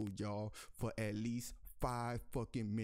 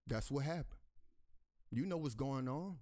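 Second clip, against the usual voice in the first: ordinary talk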